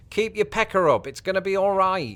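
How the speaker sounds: high-pitched imitation of a Brit from the past; put-on old-time British voice; old-time British person. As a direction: chirp it high like old-fashioned British encouragement. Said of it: "hear British people from the past say the phrase".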